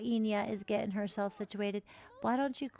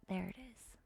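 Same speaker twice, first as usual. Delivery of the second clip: soft whispered aside; whispered to self while decorating; 8 dB quieter than usual. Real, not acted. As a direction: mutter it to yourself. Quiet aside while building.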